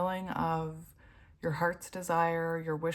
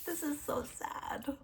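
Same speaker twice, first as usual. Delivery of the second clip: whispered, slow and softer; sad whisper; 8 semitones higher, 5 dB quieter, slower than usual. Real, not acted. Reader whispering to viewers, moved by the card.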